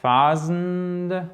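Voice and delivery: THOUSAND étiré sur un ton haut et tenu; prononciation décomposée. Shown as a demonstration. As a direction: allonge la diphtongue et le Z de thousand. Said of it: "c'est la diphtongue". (thousand au ralenti, voix plus haute)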